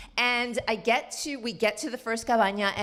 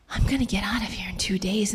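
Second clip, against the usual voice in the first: secret plan in a whisper. Real, not acted. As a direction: whisper it like a conspiracy.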